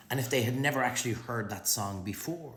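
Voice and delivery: ordinary talk, nothing marked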